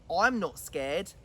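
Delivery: slow stubborn little gruffalo; low slow child gruffalo boast; defiant child monster. Acted. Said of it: "said the Gruffalo's child".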